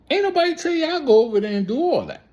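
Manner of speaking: very high, loud, rapid protest; exasperated scolding. Real, not acted. Vlogger scolding those who went to Flatbush, exasperated outburst. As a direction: scold them in a high-pitched, hurried outburst.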